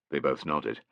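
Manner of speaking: ordinary delivery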